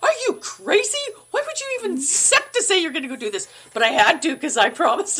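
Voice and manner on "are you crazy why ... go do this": much higher, fast panicked inner voice. Retold or imitated. Her inner voice after agreeing to audition, high panicked squeal. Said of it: "But in my head, I'm going".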